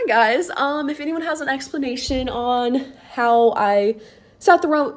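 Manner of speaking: ordinary delivery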